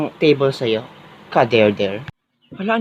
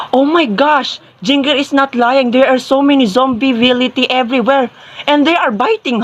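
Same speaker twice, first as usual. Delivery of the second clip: high-pitched dramatic alarm; 5 semitones higher than usual. Acted. Student confirming zombies are everywhere, alarmed. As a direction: report it in a worked-up high voice. Reports zombies seen outside.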